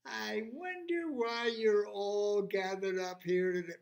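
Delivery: character voice. Acted. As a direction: greet the crowd with a lifted voice.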